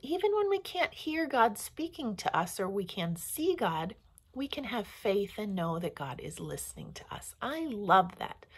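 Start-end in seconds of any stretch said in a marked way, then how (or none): none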